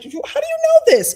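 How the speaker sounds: thrilled astonishment; delighted surprise. Retold or imitated. Armenian clerk hearing her own language, amazed and loud.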